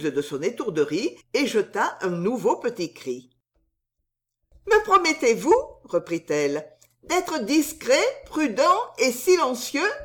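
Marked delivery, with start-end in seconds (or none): 4.7-5.6 very high, louder maid's voice; pleading soubrette. A narrator's reading. Soubrette asks for a promise. 7.0-9.9 insistent soubrette. A narrator's reading. Soubrette asks for a promise.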